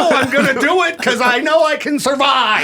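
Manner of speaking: high hammed-up bickering, half laughing; mock defiant shout. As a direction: shout it stubbornly in a play argument. Acts out argument before driving off cliff.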